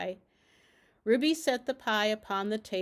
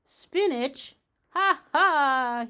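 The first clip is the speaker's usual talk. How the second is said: raised exclamation into a loud mock ha-ha; loud character cackle; 5 semitones higher, 8 dB louder, much slower than usual. A narrator's reading. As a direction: call it out, then cackle theatrically. Doctor's repeated odd cry in story.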